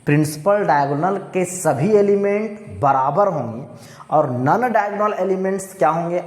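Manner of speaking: ordinary talk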